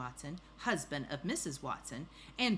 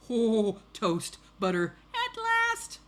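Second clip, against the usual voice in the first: loud, excited exclamations climbing very high; overjoyed storybook pig; delighted pig; 5 semitones higher, 10 dB louder, slower than usual. Acted. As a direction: relish each word, then burst out high. Pig's delighted thoughts.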